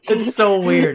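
cracking up mid-sentence; laughing. Real, not acted. Laughing at a gruesome scene.

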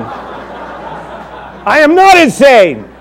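high, drawn out and giggling; very slow laughing denial. Pretend. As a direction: ham up Paul's denial while laughing. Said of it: "pretending to be Paul".

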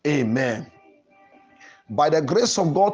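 ordinary talk, nothing marked

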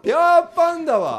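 high, loud put-on Nagoya dialect. Shown as a demonstration. Partner joins the Nagoya dialect demo, high and loud. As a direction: belt it out as mock Nagoya dialect. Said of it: "名古屋弁で。".

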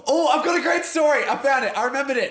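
high, loud burst of excitement; excited. Real, not acted. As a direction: blurt it out, thrilled. Suddenly remembers a story.

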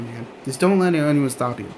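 usual speaking voice

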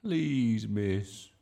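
put-on loud, drawn-out child's plea; begging. A narrator's reading. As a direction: plead with teacher. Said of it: "begged Bertie".

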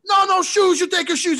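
hammed-up old man in the sauna telling Bob off; mock scolding old man. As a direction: play the old guy barking the rule at him. Old guy scolding Bob, retold.

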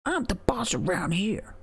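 high, whispered mock bravado; mock boss whisper. Mocking the wrestler's boast in a high whisper. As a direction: whisper it like a smug little boss.

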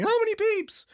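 high, loud jolt of panic; panicked cry. Retold or imitated. Director waking at night, worried about phone beeps. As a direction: bolt upright in panic and blurt it. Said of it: "I wake up at night going".

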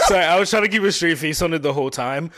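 talking through a laugh; laughing while apologizing. Real, not acted. Laughing after joke.

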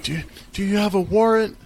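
high nervous stammering question; a scared teen bluffing; shaky voice. Retold or imitated. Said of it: "his voice is shaking".

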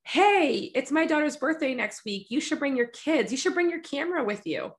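high, bright-voiced imitation of a friend angling for free photos; chirpy fake-casual invite, pitched well up; mock sweet friend. Friend inviting the photographer, sweetly hinting for free photos. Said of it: "they're always the friend that says".

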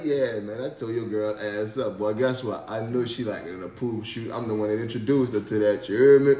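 a hint of a smug guy taunting; smug rival. Rival taunting the husband, low and mildly smug. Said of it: "he probably gonna give me that look".

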